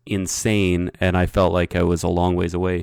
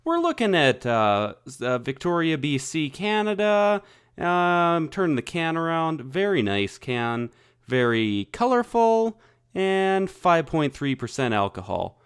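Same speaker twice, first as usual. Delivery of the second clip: ad read; 6 semitones higher, slower than usual. Acted. Sponsor-style beer review read.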